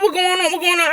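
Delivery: high-pitched mock urgent asking; urgent questioning. Cousins pull up asking what happened, high urgent questioning.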